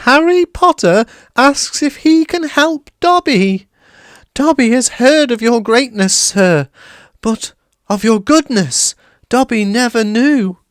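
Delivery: very high, loud elf voice; worshipful house elf; high adoring elf; awed elf. A narrator's reading. Dobby awed.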